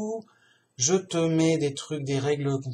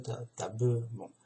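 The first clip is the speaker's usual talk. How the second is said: ordinary talk, nothing marked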